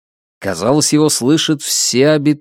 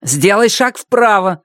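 commanding; 8 semitones higher than usual. Acted. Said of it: "велел ему Руслан".

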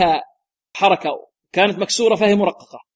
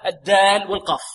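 ordinary talk

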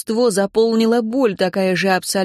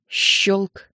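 ordinary delivery

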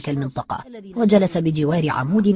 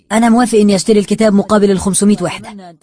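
loud, higher, rushed concession; urgent raised-voice acceptance; forceful agreement; 3 semitones higher, 8 dB louder, faster than usual. Acted. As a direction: blurt it out loudly to stop him. Forceful agreement to stop tearing.

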